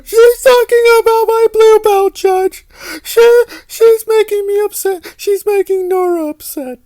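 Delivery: put-on very high, loud, whining baby voice; hammed-up tearful whine to the judge; mock whiny crybaby. Vlogger mocks Aaron complaining to a judge, in a high whine.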